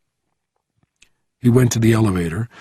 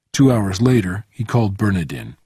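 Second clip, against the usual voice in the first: usual speaking voice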